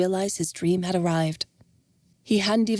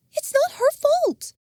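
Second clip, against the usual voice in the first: much higher woman's voice, defensive; Jenna; 3 dB louder than usual. Acted. Jenna defending Dylan, defensive. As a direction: defend her friend firmly.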